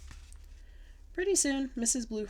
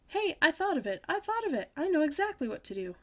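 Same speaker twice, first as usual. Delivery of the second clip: high, louder burst of discovery; excited shout; 7 semitones higher, 4 dB louder than usual. A narrator's reading. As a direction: call out a sudden bright idea. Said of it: "I shouted".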